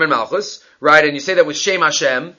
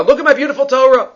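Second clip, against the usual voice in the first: proudly showing off; 7 semitones higher, 3 dB louder, faster than usual. Acted. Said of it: "They show off their beautiful Torahs".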